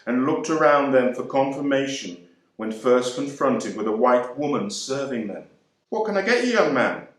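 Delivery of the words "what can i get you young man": bright, high polite server's voice; polite English waitress. A narrator's reading. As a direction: voice her as a chirpy English tea lady.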